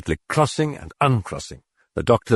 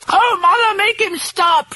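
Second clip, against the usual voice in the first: very high terrified screech; a child screeching for help. Acted. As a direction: shriek it at your mother in terror. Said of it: "she was screeching".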